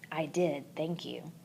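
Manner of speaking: soft unvoiced whisper; quiet aside. A narrator's reading. Hamster answering to himself, whispered. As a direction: whisper it to yourself.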